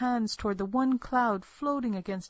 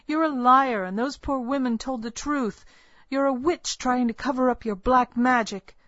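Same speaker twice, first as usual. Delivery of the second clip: louder, higher angry accusation; shouting; 5 semitones higher, 6 dB louder than usual. Acted. Girl accuses the midwife of witchcraft, shouting. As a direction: hurl the accusation at her. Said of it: "I shouted at her".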